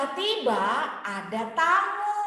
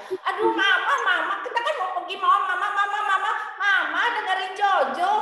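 put-on high, quick, insistent child nagging; impatient child; 8 semitones higher, faster than usual. Jojo nagging his busy mother, high and hurried. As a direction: rush it like a kid who cannot wait. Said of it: "seperti itu Jojo ngomong".

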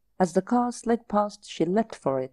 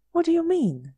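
puzzled; 10 semitones higher, 4 dB louder than usual. Acted. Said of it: "he said slowly".

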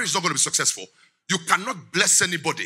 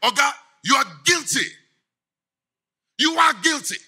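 prophet accusing; 8 semitones higher than usual. Retold or imitated. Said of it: "It took Nathan to come to give him feedback, to say".

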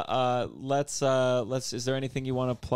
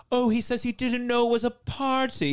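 high, posh British MP voice; a mocking parliament heckle; 10 semitones higher, 3 dB louder than usual. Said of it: "They go, they go like".

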